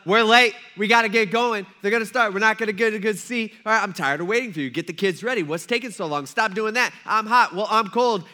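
loud, harried family squabble; mock bickering. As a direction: rush everyone out the door, fed up.

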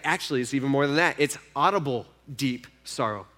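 ordinary delivery

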